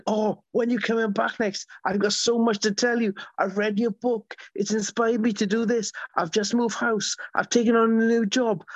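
high, eager voice of a chatty lady; excited lady quote. Retold or imitated. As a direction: gush the news like a thrilled fan.

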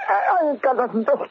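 nervous denial. Acted. Accused man pleading innocence.

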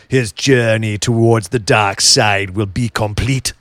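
slow, low, ominous movie-trailer voice; doom it out like a Star Wars narrator. Pretend. Co-host teasing the other, slow ominous narrator voice.

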